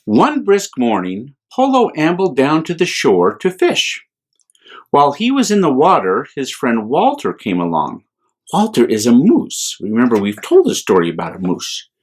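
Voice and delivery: gentle, lively bedtime reading voice; children's story reading. A narrator's reading. A priest reading a picture book to children, gently. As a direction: read it to little ones with wonder.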